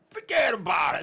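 brash New York "Forget about it"; New York accent. Shown as a demonstration. Radio host showing how New Yorkers talk, brash New York accent. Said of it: "As they say in New York".

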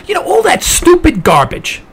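normal talking voice

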